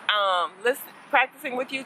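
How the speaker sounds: ordinary delivery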